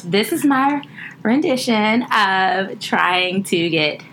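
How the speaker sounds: normal talking voice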